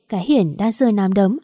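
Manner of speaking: plain reading voice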